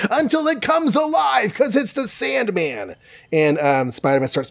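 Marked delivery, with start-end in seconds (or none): 0.1-3.0 much higher, then slowing for the Sandman; dramatic reveal. A narrator's reading. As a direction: build the reveal like a cliffhanger.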